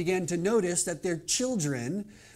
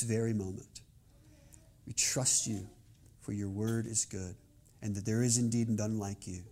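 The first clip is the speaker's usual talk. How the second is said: prayer; 6 semitones lower than usual. Real, not acted. Pastor prays before the sermon.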